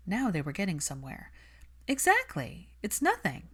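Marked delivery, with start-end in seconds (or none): 1.9-3.5 put-on lightly raised, brisk young woman; Hermione's voice. Hermione brushing off Malfoy, cool and defensive. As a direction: keep her cool and a little defensive.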